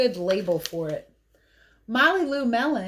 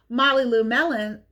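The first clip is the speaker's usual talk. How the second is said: ordinary delivery, nothing marked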